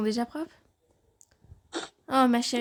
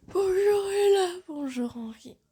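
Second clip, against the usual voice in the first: crying greeting; 8 semitones higher than usual. Acted. Tearful morning greeting.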